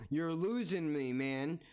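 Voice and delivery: ordinary talk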